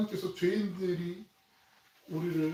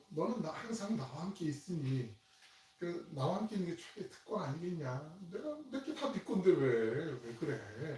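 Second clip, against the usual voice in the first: much softer father gently coaxing; father gently reasoning with his resentful older son; gentle coaxing; 2 semitones higher, 6 dB quieter than usual. Retold or imitated. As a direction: soothe your sulking son softly. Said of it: "부드러운 말로 타일듯이 가요".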